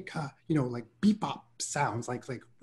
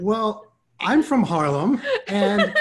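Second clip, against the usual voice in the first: slow and higher, laughing through it; laughing punchline; 8 semitones higher, 7 dB louder, slower than usual. Retold or imitated. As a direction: deliver the comeback while cracking up. Retelling his punchline to producer.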